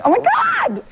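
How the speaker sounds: put-on high, gushing Valley girl squeal. Shown as a demonstration. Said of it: "You don't talk like a Valley girl".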